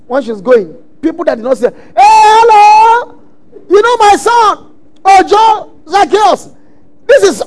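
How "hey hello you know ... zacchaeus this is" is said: sing-song grandmother introducing Zacchaeus; cheerful grandmother. Retold or imitated. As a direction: call out proudly like a grandma showing off her grandson. Grandmother introducing him to people.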